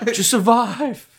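high, drawn-out mock plea to survive; Tropic Thunder movie impression. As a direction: stretch it out in a high movie-quote voice. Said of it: "Tropic Thunder".

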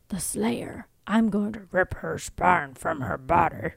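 throat-straining monster growl; villain growl. Acted. Companion threatening the Slayer from shadow, growling. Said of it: "you're gonna lose your voice".

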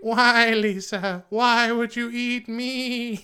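high, slow pleading ghost lamb. Acted. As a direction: plead like a haunted little lamb. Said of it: "hallucinations of the lamb".

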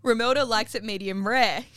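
ordinary talk

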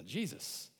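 usual speaking voice

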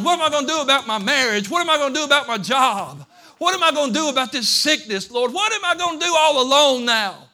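high, loud pleading shout; desperate cry to God. Acted. Broken-down believer crying out to God, desperate shout. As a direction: wail it out, then plead at full voice. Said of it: "all you could do was go".

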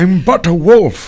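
loud, slow, hammed-up wolf; audiobook wolf voice. Mocking the book on tape's wolf character, hammed-up slow declaiming. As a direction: declaim it high and loud as a mock noble beast. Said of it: "Does he talk like a dog?".

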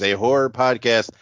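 normal talking voice

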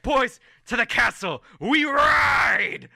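high, loud shout, last word stretched; rallying battle cry. Acted. Colm on horseback to his boys, rallying shout. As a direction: cry out to lead the charge. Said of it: "He's like".

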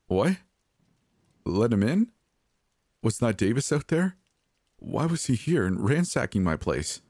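high, incredulous questions; bewildered alarm. A narrator's reading. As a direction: pitch each question up in confused disbelief. Frightened, baffled by the demand.

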